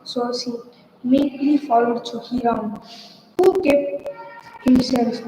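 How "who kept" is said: ordinary delivery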